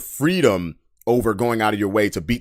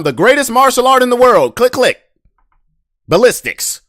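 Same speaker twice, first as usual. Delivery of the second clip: loud, much higher exclaim; excited shout; 14 semitones higher, 8 dB louder than usual. Real, not acted. Telling people the best martial art, shouted higher. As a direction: build to the punchline with a shout. Ranting, gun punchline.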